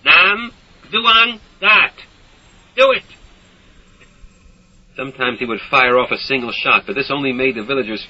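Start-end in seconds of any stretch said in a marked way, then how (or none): 0.0-3.0 shouting orders. A narrator's reading.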